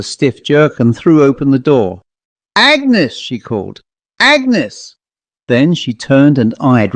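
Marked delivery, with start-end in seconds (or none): 2.6-3.1 high call across the house. Acted. Missus Dent calls Agnes through the open door, high call. Calling out to the girl. 4.2-5.0 high call across the house. Acted. Missus Dent calls Agnes through the open door, high call. Calling out to the girl.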